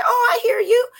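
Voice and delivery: high, loud and mock sweet oh I hear you; mock polite. Brushing off unwanted advice, mock sweet and high. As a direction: fake agreement, sing it out high. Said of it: "I say".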